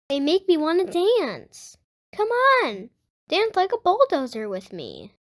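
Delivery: lively picture-book read-aloud; reading to children. Acted. Host reading a Blippi book to young viewers, lively read-aloud. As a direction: read it out to little kids, bright and clear.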